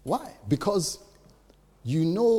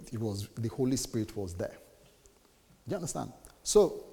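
usual speaking voice

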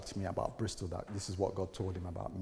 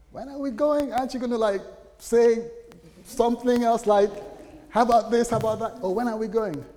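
much higher, louder, exasperated disbelief; incredulous mock; 13 semitones higher, 10 dB louder than usual. Husband mocking wife's instant agreement, high and exasperated. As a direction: echo her with comic outrage.

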